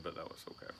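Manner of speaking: muttered sulky whisper; whispered aside. Real, not acted. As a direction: grumble it under your breath. Mock-hurt aside about not invited.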